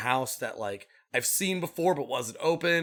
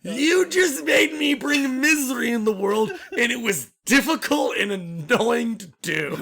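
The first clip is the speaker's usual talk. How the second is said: mock sobbing; 9 semitones higher, 5 dB louder, slower than usual. Fake sob after the accusation.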